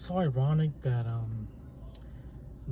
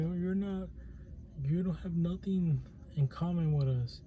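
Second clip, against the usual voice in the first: put-on Indian accent; 4 semitones higher, 5 dB quieter than usual. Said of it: "I don't know why I said that in, in the Indian s-, accent".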